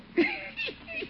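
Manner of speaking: very high soft giggle. Acted. Housekeeper giggling at Leroy by the window, high giggle. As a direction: giggle watching the kids.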